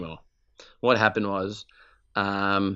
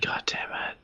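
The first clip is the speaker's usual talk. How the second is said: whispered under the breath, no voice at all; muttered curse; 10 dB quieter than usual. Retold or imitated. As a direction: hiss it out unvoiced, crushed. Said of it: "I was just like".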